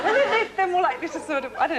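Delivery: high, loud, giggly stammer; laughing through words. Real, not acted. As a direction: talk while the laugh takes over.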